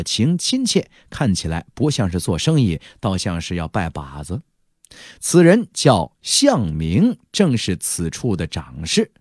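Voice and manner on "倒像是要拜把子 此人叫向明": ordinary delivery, nothing marked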